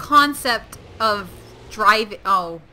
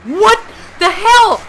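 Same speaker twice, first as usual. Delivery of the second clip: loud, higher, drawn-out shocked cry; shocked outcry; 10 semitones higher, 12 dB louder, slower than usual. Real, not acted. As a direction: blurt it out in alarm. Startled by attack.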